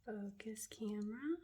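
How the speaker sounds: soft whispered coaxing. Real, not acted. Coaxing the camera to focus, whisper.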